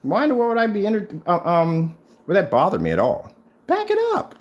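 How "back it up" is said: high, sing-song taunt; taunting challenge. Real, not acted. Taunting rival Crow to prove his product, high sing-song goad. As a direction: goad him with a teasing high lilt.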